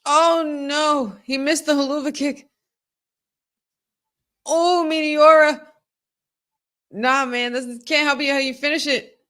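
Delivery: high, loud groaning at the screen; live fan agony; excited dismay. Real, not acted. Fan watching a missed finisher, high excited dismay.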